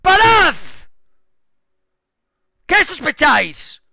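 high, loud, slow yelling; exasperated shout. Real, not acted. Streamer yelling at suspicious teammates, exasperated shouting. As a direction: yell at them to stop.

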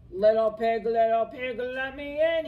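loud wolf growl; hungry wolf growling at the stick house door. A narrator's reading. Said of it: "he growled".

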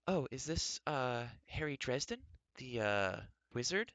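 high, hesitant apologetic voice; timid caller afraid she insulted him; apologetic woman. A narrator's reading. Timid caller to the wizard, apologetic and unsure. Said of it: "Her tone was apologetic".

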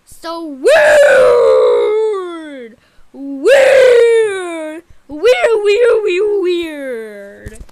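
loud wailed cry, stretching the vowel; drawn-out exclamation. Pretend. Reacting to someone acting weird, loud drawn-out exclamation. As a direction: cry it out in long swoops. Baffled outcry at tantrum.

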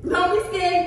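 loud teasing encouragement. Real, not acted. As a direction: egg him on.

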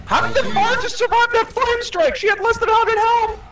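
high, loud disbelief; outraged shout. Real, not acted. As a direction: yell it in disbelief. Outraged at a game result.

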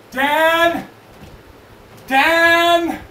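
high, loud drawn-out call. Acted. [name] summoning Dan from elsewhere, loud calling out. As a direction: holler the name to summon someone.

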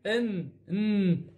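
ان very slowly, the n held long; drawn-out teacher's demonstration of ان; letter sound "enn". Shown as a demonstration. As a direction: stretch ان into a humming "ennn". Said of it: "هذا قلنا ان".

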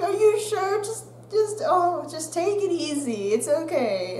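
high, soothing worried voice; teacher calming student down, fussing gently; fussing concern. Retold or imitated. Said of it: "they're like".

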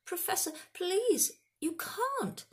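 high-pitched, desperate begging; desperate pleading. A narrator's reading. Student begs professor over lost points, high and pleading.

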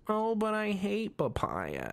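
high, slow whining complaint; mock whine. Katara whining after the door slams, slow high whine. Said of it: "and she goes".

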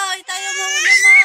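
fast, loud and high cheer; excited shout. Real, not acted. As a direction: cheer her on as she lifts her head. Cheering baby lifting head.

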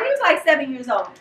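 ordinary talk

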